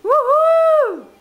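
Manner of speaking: high, loud joyful whoop; excited cheer. Real, not acted. Mother cheers loudly.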